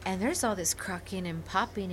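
normal talking voice

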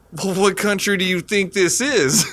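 a lightly hammed-up smartass reply; sarcastic retort. Brother mouths off to the border guard, sarcastically.

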